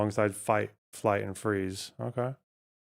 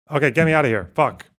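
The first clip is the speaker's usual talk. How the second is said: normal talking voice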